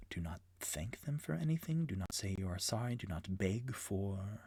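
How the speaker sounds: hushed warning whispered to his friends; under his breath. Acted. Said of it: "under his breath he says".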